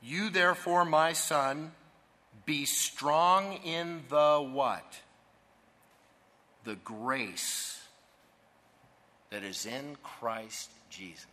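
slow, emphatic reading of the verse; scripture reading. Acted. Preacher to congregation, reading the verse slowly. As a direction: read the verse out to the congregation, deliberately.

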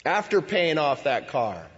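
ordinary delivery

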